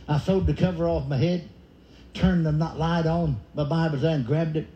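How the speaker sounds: usual speaking voice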